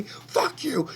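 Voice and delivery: high, loud yelled "Fuck you"; screaming fuck you. Retold or imitated. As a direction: spit "Fuck you" out as a furious yell. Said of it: "starts screaming at them".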